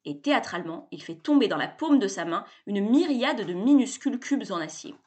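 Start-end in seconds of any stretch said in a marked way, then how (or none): none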